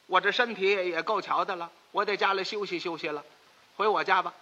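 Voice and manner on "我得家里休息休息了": ordinary delivery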